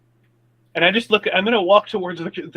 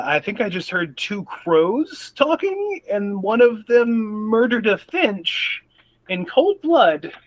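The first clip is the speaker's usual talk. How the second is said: slow, higher, rattled; slow and shaken; 6 semitones higher, slower than usual. Acted. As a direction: spell it out slowly, unnerved. Shaken, telling friends.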